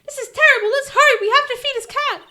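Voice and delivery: put-on high, loud excited girl voice; urgent and bouncy; excited Maya. Maya urging Phoenix to feed the cat, high and loud.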